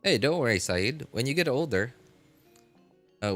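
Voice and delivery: ordinary talk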